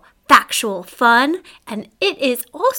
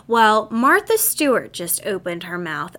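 ordinary talk, nothing marked

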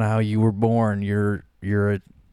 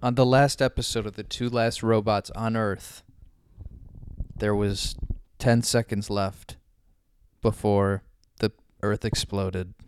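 movie trailer narrator. Acted. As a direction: announce the epic tale.

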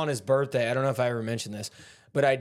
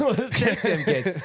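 laughing quote; 6 semitones higher, slower than usual. Retold or imitated. Quoting Sonny, laughing.

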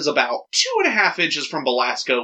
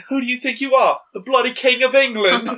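high outraged shout; indignant royal yell; freaking out; 10 semitones higher, 3 dB louder than usual. Retold or imitated. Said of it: "freaking out".

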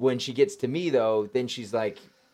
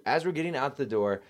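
ordinary talk

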